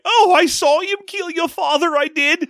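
high-pitched British maid, hammed-up; fake British accent. Maid finally telling on the killer, fake British accent. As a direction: play a squawking British maid in a bad accent. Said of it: "She's doing a fake British accent".

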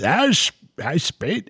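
high, faltering stab at Australian; false start at an Australian voice; attempted Australian accent. Host trying the trucker's complaint, fumbled Australian accent. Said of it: "Wait, in an Australian voice".

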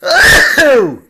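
sudden loud real sneeze. Real, not acted. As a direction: let out a big sneeze. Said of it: "I'm gonna sneeze".

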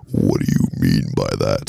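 very low, slow sultry voice. Shown as a demonstration. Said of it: "talk in the sultry voice".

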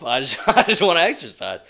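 laughing loudly through the words; real laughter breaking into the line. Real, not acted. Laughing at own joke.

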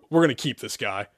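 ordinary delivery, nothing marked

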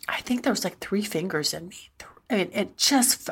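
hushed whisper, no voice. Real, not acted. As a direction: breathe it out secretly. Intimate confession, hushed detail.

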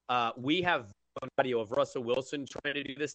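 ordinary talk

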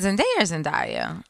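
first Zendaya as "zen-DAY-a", second as "zen-DIE-a"; Zendaya both ways. Shown as a demonstration. Host asking friends which is right, Zendaya as "zen-DAY-a", then "zen-DIE-a". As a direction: set "day" against "die" in the two takes of Zendaya. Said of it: "I honestly say it both ways".

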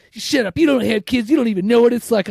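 hammed-up furious mother; mom snapping back. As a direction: snap back like the nagging mom. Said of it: "she's like".

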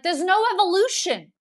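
high, loud, incredulous retort; exasperated protest. Retold or imitated. Café worker answering manager's talk of evolution, exasperated protest. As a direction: shoot the idea down loudly.

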